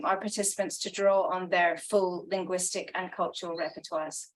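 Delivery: ordinary talk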